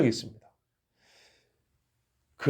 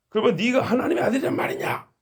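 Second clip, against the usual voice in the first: high mocking yell of disbelief; angry shouted question; 7 semitones higher than usual. Council members shouting at Jesus, high angry disbelief. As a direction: fling the question like an angry crowd. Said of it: "막 여기저기서 막 이제 큰 소리로 물어본 거예요".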